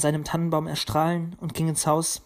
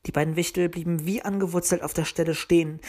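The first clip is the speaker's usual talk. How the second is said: usual speaking voice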